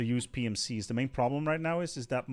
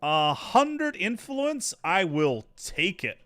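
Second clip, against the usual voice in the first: excited; 7 semitones higher, 8 dB louder, much slower than usual. Real, not acted. Gloating over a resource bonus.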